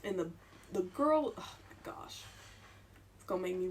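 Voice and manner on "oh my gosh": ordinary delivery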